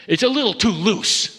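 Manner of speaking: mock doubter. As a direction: sneer it like a doubter with folded arms. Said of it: "fold their arms and say".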